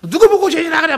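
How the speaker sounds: high mock-indignant retort; retold angry attack. Offended coworker hits back at evangelist, mock anger. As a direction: act out lashing back at an accuser. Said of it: "막 이러면서 이제 막 공격을 했다는 거예요".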